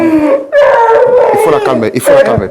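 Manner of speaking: loud, high, drawn-out call for help; urgent call. Real, not acted. Host calling to the crew to calm her down. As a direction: call out slowly so everyone hears.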